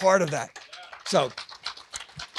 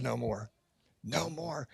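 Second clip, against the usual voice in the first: much softer, lower hushed no more; quiet scripture echo; 4 semitones lower, 9 dB quieter, slower than usual. Retold or imitated. People in Scripture refusing gifts, hushed and soft. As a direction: let it fall away reverently. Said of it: "where people said".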